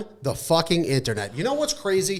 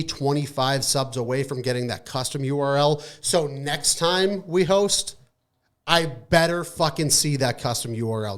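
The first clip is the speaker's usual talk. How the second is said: usual speaking voice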